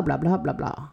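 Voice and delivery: usual speaking voice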